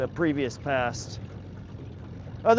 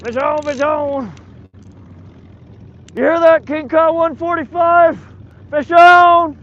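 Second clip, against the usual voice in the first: shouting across the water, higher; calling out; 11 semitones higher, 12 dB louder than usual. Real, not acted. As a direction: yell it out to the far boat. Calling out to other boat.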